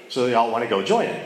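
normal talking voice

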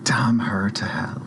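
slow, whispered oath; whispered curse. Acted. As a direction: curse her slowly under your breath. Cursing the suspected culprit in character.

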